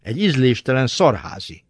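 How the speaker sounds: usual speaking voice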